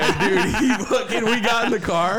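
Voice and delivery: high, loud laughing excitement; excited laughing. Real, not acted. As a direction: squeal it through laughter.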